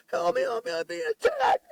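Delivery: very high, piercing cry for help; a screamed plea from up the street; imitated scream for help. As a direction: shriek it like someone being attacked. Retold stranger screaming for help.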